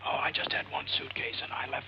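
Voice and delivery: voiceless whisper. Pretend. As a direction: drop into a hushed whisper. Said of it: "What are you whispering about?".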